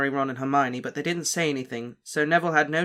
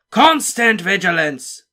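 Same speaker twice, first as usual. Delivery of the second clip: bellowed, slow and much higher; roared; 6 semitones higher, 10 dB louder, slower than usual. A narrator's reading. Moody roars a warning at the class, booming. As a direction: roar it to make the class jump. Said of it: "he roared".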